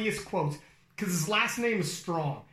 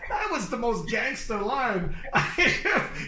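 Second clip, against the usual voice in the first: real laughter, much higher, slow; words squeezed out through laughs; laughing; 4 semitones higher, 3 dB louder, slower than usual. Real, not acted. Host cracking up over a lifter's line. Laughing while retelling quote.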